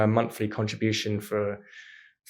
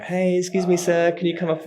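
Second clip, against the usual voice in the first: higher-pitched imitation of a charity fundraiser; mock street hail; 7 semitones higher than usual. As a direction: hail a passer-by in a sing-out voice. Acting chuggers hailing passers-by.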